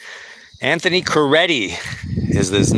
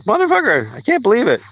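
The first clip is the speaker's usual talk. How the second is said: upset outburst; 11 semitones higher, faster than usual. Retold or imitated. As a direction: spit it out, furious at being fired. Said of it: "I was really fucking upset".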